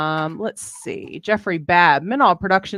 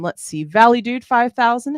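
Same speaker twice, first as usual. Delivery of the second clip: ordinary talk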